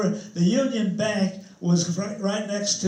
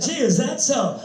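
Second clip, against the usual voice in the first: feigned surprise, higher pitch; mock innocence; 5 semitones higher, 4 dB louder than usual. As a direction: play dumb, holding back a laugh. Plays innocent to the landlord's victim.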